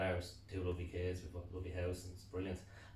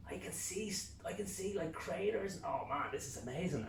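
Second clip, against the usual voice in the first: higher pitched wonder; amazed; 10 semitones higher than usual. Retold or imitated. Man at the moon, high amazed wonder. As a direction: gush with amazement.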